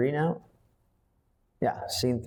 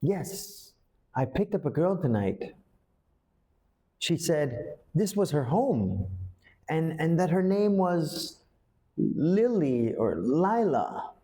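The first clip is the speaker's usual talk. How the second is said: higher and halting, slowing on Lily or Lila; uncertain, can't remember; 6 semitones higher than usual. Shown as a demonstration. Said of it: "you say it like that because you can't really remember everything".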